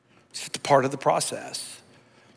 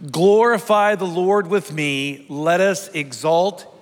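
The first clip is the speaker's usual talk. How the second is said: slow, raised proclaiming voice; leading congregational psalm reading; 3 semitones higher, 3 dB louder, slower than usual. Acted. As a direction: lead the church in reading aloud, drawing out each phrase.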